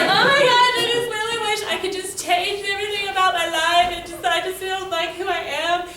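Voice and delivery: put-on high, loud melodramatic teen whine; mock wailing. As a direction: imitate a hammed-up, sing-song outburst. Retold wailing to her mom.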